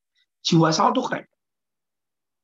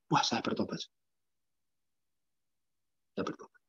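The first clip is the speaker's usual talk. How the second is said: fading to a whisper; quiet repentance; 5 semitones lower, 10 dB quieter than usual. Real, not acted. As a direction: confess it softly, almost breathless.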